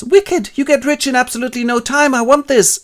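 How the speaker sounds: high and loud, gleeful; thrilled at getting rich quick; greedy excitement. Acted. Robinson discovering sugarcane plantations, high and greedy.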